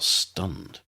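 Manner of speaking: plain reading voice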